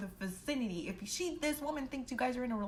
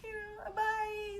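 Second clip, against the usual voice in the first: awkward goodbye; 11 semitones higher, 3 dB quieter, slower than usual. Retold or imitated. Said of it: "I was like".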